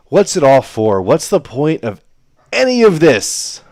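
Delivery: loud, high hammed-up existential wail; mock despair. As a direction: lament the pointlessness theatrically. Commentator mock despairs at the play.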